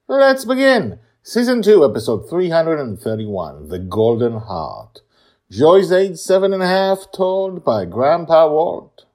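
loud, high title announcement; announcer voice. Acted.

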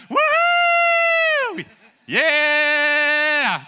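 long, high whooping holler; shouting. Retold or imitated. Preacher bursting out the side door, jubilant shouting. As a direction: whoop it out at full volume. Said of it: "Man, I was shouting".

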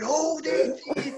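slow, high "Hold eighteen!" called out, each syllable stretched; imitated shout. Teammate imitates Big Reed's drawn-out high free-kick shout. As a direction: mimic a captain bellowing "Hold eighteen!" slowly. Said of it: "that's what Big Reed would shout".

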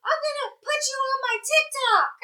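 high, called-out thanks from a car; lightly yelled goodbye; mock yell. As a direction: shout it as you drive past. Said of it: "he yells out".

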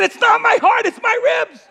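very high, loud comic yell; exasperated protest. Retold or imitated. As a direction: shout it back at the doctor in exaggerated protest. Acted protest to the doctor.

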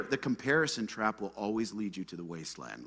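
usual speaking voice

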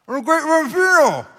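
put-on very high, muffled kid voice, words unintelligible; garbled Porter impression. Preacher imitating a kid nobody can understand, garbled. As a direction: mangle it in a high-pitched mock voice. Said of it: "Can't understand him".

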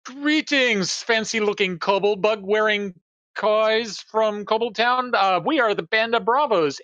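high, slow, pompous announcer voice, pitched well up; grand herald greeting. Acted. Bard hailing the kobold guards, slow and high-pitched. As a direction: puff it up like a showman hawking his troupe. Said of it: "I will say".

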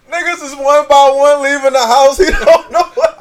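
loud and high, laughing through it; high laughing excitement. Real, not acted. Laughing as he tells how the guy missed it. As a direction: crack up while telling it.

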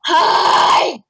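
loud shouted Bigfoot hello. Acted. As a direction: bellow it like Bigfoot. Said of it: "that's a good Bigfoot voice".

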